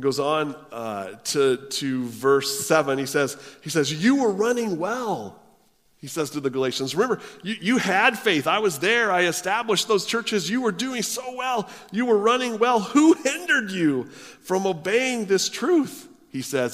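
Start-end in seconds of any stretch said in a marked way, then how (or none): 3.9-5.4 higher, slower, dismayed appeal; exasperated Paul. Retold or imitated. Paul chiding the Galatians for straying, exasperated. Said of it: "He's exasperated". 6.9-16.1 higher, louder, pleading reproach; exasperated Paul. Retold or imitated. Paul reminding the Galatians he founded their churches, exasperated. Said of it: "He's exasperated".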